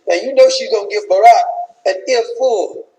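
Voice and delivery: ordinary talk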